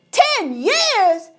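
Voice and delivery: high, startled cry; shocked gasp. A narrator's reading. King gasps at the magicians' ten-year promise. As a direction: gasp it in disbelief. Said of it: "gasped the king".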